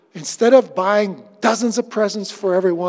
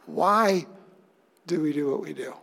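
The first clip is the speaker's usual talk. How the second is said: hushed question; 6 semitones lower, 8 dB quieter than usual. Acted. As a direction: murmur it like a question to your own heart. Pastor repeating the searching question.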